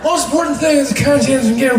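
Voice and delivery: loud, high, run-together mumble; Joe Biden, mumbling. Acted. President making a point, loud mumbling voice. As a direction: slur the words into one unintelligible string. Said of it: "does that weird mumbling thing".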